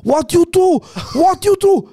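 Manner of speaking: high, loud outraged yelling; imitated screaming. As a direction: mimic a man shouting in broken English. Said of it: "fängt an zu schreien".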